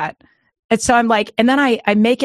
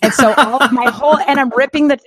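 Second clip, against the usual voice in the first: laughing; 6 semitones higher, 6 dB louder than usual. Real, not acted. Laughing retelling bathroom chaos.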